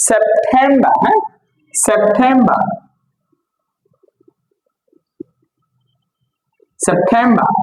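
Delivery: সেপ্টেম্বর as sep-THEM-ba, aspirated t; smart pronunciation. Shown as a demonstration. Teacher drilling the smarter way, smart pronunciation. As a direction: drill সেপ্টেম্বর without final r. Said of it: "একটু smartly বলতে পারি".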